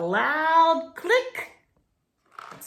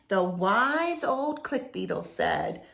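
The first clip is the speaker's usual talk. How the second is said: ordinary delivery, nothing marked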